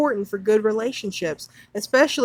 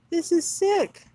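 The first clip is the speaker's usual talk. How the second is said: disgusted outburst; 7 semitones higher than usual. Real, not acted. Rant about abortion info.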